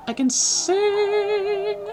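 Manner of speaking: loud, high, drawn-out proclamation; excited proud shout. Real, not acted. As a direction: belt out the boast slowly. Listing skills, climax.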